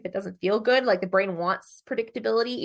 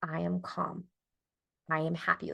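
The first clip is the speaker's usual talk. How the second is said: soft affirmations; 6 dB quieter than usual. Shown as a demonstration. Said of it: "And we say something like".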